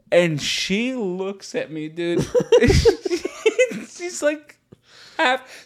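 normal talking voice

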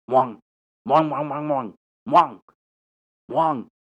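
imitated dog, Korean onomatopoeia; Korean-style dog barking. Shown as a demonstration. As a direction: bark out "mwang" like a dog. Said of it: "But in Korea, They hear".

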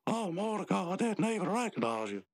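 high, acted female exclamation; woman's voice. Margaret suddenly recognizing Mordecai, high-pitched woman's voice. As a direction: raise the pitch to play her. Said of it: "And she goes".

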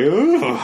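high, drawn-out "ooh"; cringing ooh. Retold or imitated. Podcaster recalling reaction to the story, cringing ooh.

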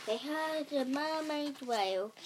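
ordinary delivery, nothing marked

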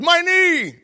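teammate's high yelp of pain; pained yell. Retold or imitated. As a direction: yelp as the knee gives out.

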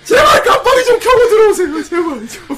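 very high, loud, drawn-out wail; exasperated pleading. Real, not acted. Host begging donor to warn first, shrieking plea. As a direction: plead at the top of your voice, half laughing. Host startled by sudden big donation.

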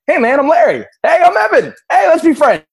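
high, loud, hammed-up buddy voice; goofy shouted introduction; mock excited greeting. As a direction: play it like overeager new friends. Retold meeting, playful voices.

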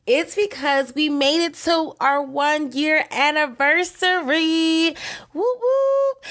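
high, slow, then a squealed whoop whoop; celebrating a milestone with her listeners, thrilled; excited. Real, not acted. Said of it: "I am so excited".

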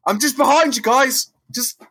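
high, mock-casual call; high-voiced Spider-Man. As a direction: call out brightly to cover for yourself. Spider-Man pretending to be a thug.

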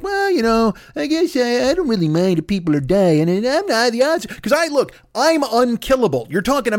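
mock the shruggers in a put-on voice; dismissive people. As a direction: run it together in a high, silly tone. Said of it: "People are like".

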